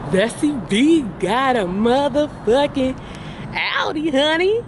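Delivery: high, proud reveal; big proud car reveal; excited reveal. Real, not acted. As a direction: announce it like a triumph. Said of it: "what did Bessie B get?".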